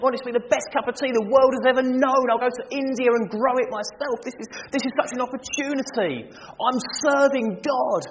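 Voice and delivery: high, loud, tumbling with eagerness; eager excitement. Acted. Preacher to congregation, imagining serving Christ tea, thrilled and loud. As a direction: gush like you'd run to the kitchen for him.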